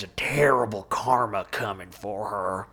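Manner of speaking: whispered doom, low; ominous whisper. Retold or imitated. Mocking Cody's warning about Christine's karma, whispered. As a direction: hiss it like a curse. Quoting Cody's dramatic line.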